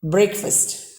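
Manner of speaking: BREAKFAST as "brek-fust"; correct breakfast. Shown as a demonstration. Teacher models the pronunciation given here, "brek-fust". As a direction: say "Breakfast" with short e, weak second syllable. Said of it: "See the pronunciation given here".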